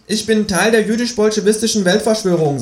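viel höhere, freche Figurenstimme; Känguru-Stimme. Acted. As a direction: das Känguru hoch und keck sprechen. Erzähler spricht das Känguru. (hohe, kecke Känguru-Stimme)

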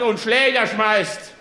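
normal talking voice

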